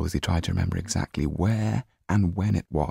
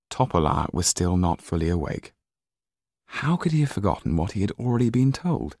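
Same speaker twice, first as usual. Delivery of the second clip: ordinary delivery, nothing marked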